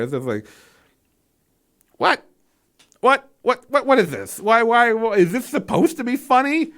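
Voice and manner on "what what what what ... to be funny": repeated incredulous whats, rising high on funny; baffled disbelief. Real, not acted. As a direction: react as if the scene makes no sense. Baffled by a joke in horror.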